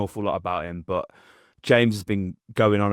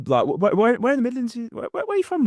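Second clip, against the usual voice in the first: rising higher and higher with excitement; mock-eager interviewer pressing for an answer; eager pestering. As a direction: keep pushing the question, getting squeakier each time. Pressing a guest about hometown.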